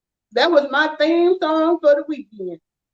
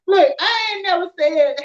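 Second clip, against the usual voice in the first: normal talking voice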